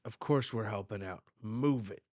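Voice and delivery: loud, fast barked order; quick, loud commanding shout; commanding Garp. Retold or imitated. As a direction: push the troops to move at once. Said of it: "And Garp says".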